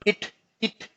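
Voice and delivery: "इट" said with a short, clipped i, not a long ee; short i sound. Shown as a demonstration. Teacher showing students the short i sound in "इट". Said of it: "लंबा ई नहीं पढ़ेंगे".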